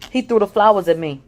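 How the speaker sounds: ordinary delivery, nothing marked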